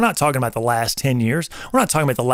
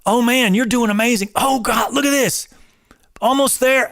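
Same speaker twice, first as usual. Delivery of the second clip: high, pumped-up cheering; trainer hype; 8 semitones higher than usual. Retold or imitated. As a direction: hype a client through the last reps. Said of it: "After they get to the fifteenth rep".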